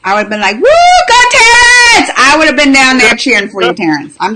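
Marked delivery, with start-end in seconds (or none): 0.6-2.1 higher, slow, stretched-out cheer. Pretend. Friend pretending to cheer him on from below, cheering. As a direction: whoop it like a fan in the stands. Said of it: "I woulda cheered for you".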